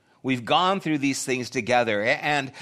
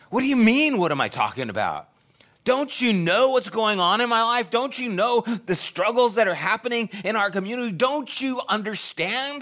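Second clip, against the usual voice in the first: much higher and louder, indignant and pleading; exasperated outburst; 10 semitones higher, 4 dB louder than usual. Acted. Disciples protesting to Jesus, exasperated and loud. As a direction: throw the questions back at him in disbelief.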